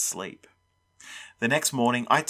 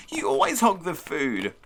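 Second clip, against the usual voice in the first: higher-pitched creature complaint; little monster screaming; 6 semitones higher than usual. A narrator's reading. Little monster to food-hogging big one, screechy complaint. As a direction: scold in a small monster's voice. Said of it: "the little one screamed".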